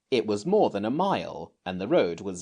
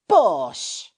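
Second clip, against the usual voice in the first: louder scoffing bark; dismissive; 7 semitones higher, 7 dB louder than usual. Acted. Robert scoffs at Jane, loud. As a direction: brush her off with scorn.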